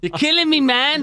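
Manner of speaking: imitating a pained high cry; high-pitched mimicry. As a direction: wail it in a put-on high voice. Impression from mocked boxer video.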